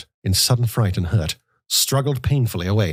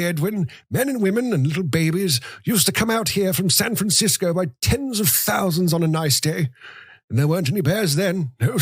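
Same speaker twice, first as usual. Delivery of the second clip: put-on high, thin voice of a grumbling old man; old grandfather; 9 semitones higher than usual. A narrator's reading. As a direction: reminisce like a frail, complaining grandfather.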